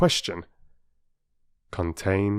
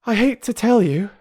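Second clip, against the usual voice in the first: breathless; 6 dB louder than usual. A narrator's reading. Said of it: "she said breathlessly".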